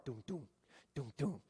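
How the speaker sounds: soft, muffled doom doom like a beating heart; whisper-soft thumping heartbeat sounds; heartbeat thumps. Retold or imitated. Imitating the telltale heartbeat.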